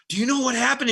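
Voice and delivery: lightly voiced gossiper; eager gossip. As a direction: lean in like you have news. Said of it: "someone says".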